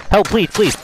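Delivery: panicked pleading. Real, not acted. Sniped, begging for help.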